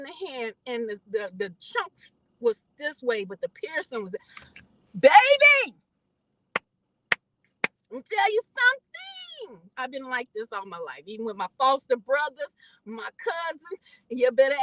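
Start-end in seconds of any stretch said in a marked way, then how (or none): 5.0-9.7 much higher, loud, insistent; addressing the audience with fire; emphatic aside. Real, not acted. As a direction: lean in and insist on it.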